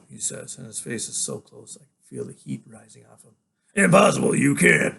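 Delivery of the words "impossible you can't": loud, raised rebuttal; forceful denial. Acted. Father denying his son's memory, loud forceful rebuttal. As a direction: throw the accusation back at him loudly.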